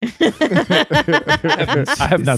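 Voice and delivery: laughing. Retold or imitated. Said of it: "Maybe with a Joan Crawford voice".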